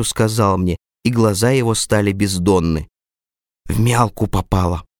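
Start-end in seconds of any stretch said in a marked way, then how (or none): none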